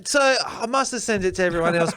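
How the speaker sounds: ordinary talk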